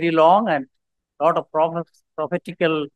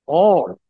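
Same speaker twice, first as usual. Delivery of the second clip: "All" set apart and punched; stress on ALL; 5 dB louder than usual. Shown as a demonstration. Preacher highlights a verse word for congregation, stress on "All". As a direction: hit "All" firmly, then pause. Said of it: "highlight two words in there".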